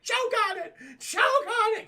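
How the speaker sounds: high mock shout in German accent. [name] cursing the zombies, shouted German accent. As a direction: yell it with a put-on German accent. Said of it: "[name] with a German accent".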